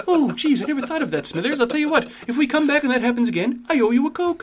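put-on high, thin old boss voice; Mr. Burns voice. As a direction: squeak it out like a frail old tycoon. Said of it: "he was like".

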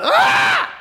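high, piercing scream; loud cry. Acted. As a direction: let out a sudden demonic yell. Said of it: "lets out a".

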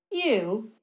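scoffing, dismissive; scornful snort. Acted. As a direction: sneer at him. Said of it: "she snorted".

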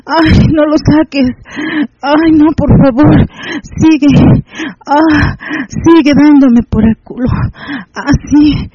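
crying out please, don't stop; pleading cries. Acted. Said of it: "a lo que yo le grité".

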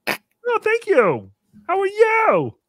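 very high, loud mock-friendly voice; oblivious viewer. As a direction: greet them in a hammed-up loud squeal. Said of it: "would look down and then go".

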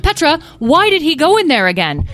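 frazzled parental outburst; exasperated mother. A narrator's reading. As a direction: demand an answer while juggling children. Mother at end of patience.

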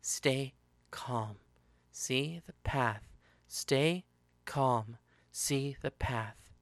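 soft slow inner mantra, words spaced apart; repeated in her mind. Acted. Fleeing killer calming herself inwardly, soft mantra. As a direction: murmur it to steady yourself. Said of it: "in her mind she repeated".

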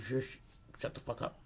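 whispered, urgent shush; acted whisper to keep someone quiet; hissed shushing. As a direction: shush them under your breath. Boy shushing the waitress.

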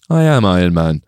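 low, deadpan hero line; quoted movie hero. Acted. Fan quoting the film's ending, low heroic declaration. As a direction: deliver the famous line low and level.

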